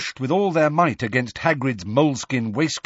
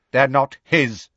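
higher, scornful interruption; contemptuous; 4 semitones higher, 4 dB louder than usual. A narrator's reading. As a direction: cut in with contempt. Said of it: "interrupted Bane contemptuously".

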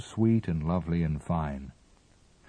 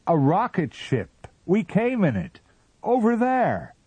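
much higher and loud; excited shouting; 13 semitones higher, 5 dB louder than usual. A narrator's reading. Captain shouts to the Martian about the ship, high and eager.

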